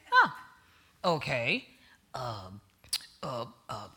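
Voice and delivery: low, hesitant mumbling caller voice, full of uh and um; thief on the phone. Retold or imitated. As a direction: play the nervous car thief calling, voice pitched low. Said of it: "it goes like, something like this".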